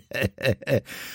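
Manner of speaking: gleeful, scheming laugh; acted gloating laugh; malicious chuckle. A narrator's reading. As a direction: chuckle spitefully to oneself. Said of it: "złośliwy uśmiech nie schodził".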